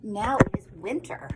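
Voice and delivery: ordinary delivery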